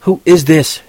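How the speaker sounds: riled woman on the phone; near yelling. A narrator's reading. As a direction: demand the caller's name sharply. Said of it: "She was damn near yelling".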